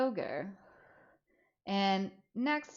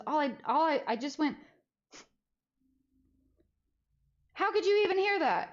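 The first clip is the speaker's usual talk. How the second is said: much higher, mock-innocent; mom's retold remark; 8 semitones higher, 3 dB louder than usual. Mom retelling her firing, higher mock-innocent. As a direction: replay her little jab. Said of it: "And she goes".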